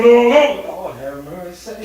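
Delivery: normal talking voice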